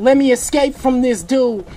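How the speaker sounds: ordinary talk, nothing marked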